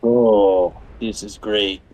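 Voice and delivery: ordinary talk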